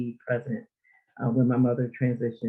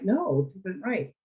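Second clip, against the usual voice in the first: slow, higher-pitched mild protest; hurt child; 6 semitones higher, slower than usual. Retold or imitated. Inner child protesting for her mother, lightly hurt protest. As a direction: lightly voice a wronged child objecting. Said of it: "that child that felt for her mother".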